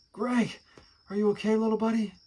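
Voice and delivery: uncle's high, quick mock-sad voice; a hammed-up worried uncle, high and fast; said sadly. A narrator's reading. Uncle fussing over hurt nephew, mock sad. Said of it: "Uncle Billy said sadly".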